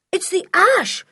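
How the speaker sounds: high, loud shout of warning; urgent high-pitched cry of danger; alarmed yell. A narrator's reading. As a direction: yell it, stretching the last word. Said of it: "yelled Julian".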